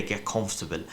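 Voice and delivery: ordinary talk, nothing marked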